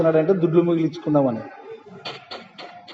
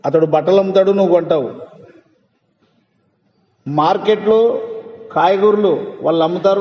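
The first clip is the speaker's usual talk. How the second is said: usual speaking voice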